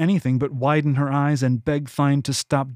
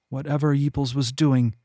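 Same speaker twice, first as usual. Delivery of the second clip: ordinary delivery, nothing marked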